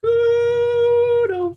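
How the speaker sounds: Kudo sung out very high; squeaky mock voice. Mocks the name in high voice.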